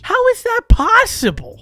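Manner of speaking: high, loud disbelief; loud, high-pitched protest; incredulous outburst. Real, not acted. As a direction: cry out in exasperated disbelief. Outraged at time limit.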